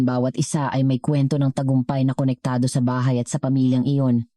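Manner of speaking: plain reading voice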